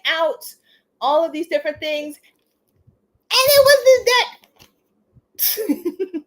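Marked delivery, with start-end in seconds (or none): 2.2-6.2 high, loud and very slow, each word stretched out in delight; thrilled reveal. Real, not acted. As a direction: draw out the words in disbelief at the find. Finding the wanted deck.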